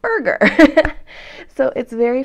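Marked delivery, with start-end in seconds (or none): 0.0-0.4 "burger" read like English burger, high and loud; spelled out. Shown as a demonstration. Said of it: "which is spelled". 0.4-1.5 loud high laugh; real laugh at the joke; burst of laughter. Real, not acted. Laughing at the word.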